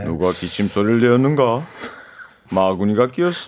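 low, deep tyrant king voice; Gungye impression. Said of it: "서로 오디션을".

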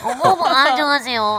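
attempted mother voice, laughing; unconvincing imitation with a laugh; failed mother impression. Shown as a demonstration. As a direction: try the mother's greeting and miss. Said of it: "아, 이거 안 되네".

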